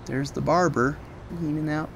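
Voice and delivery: ordinary delivery, nothing marked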